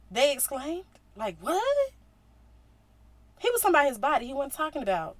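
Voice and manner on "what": much higher, stunned what; shocked what. Retold or imitated. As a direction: gasp it out in disbelief. Crowd reacting in shock.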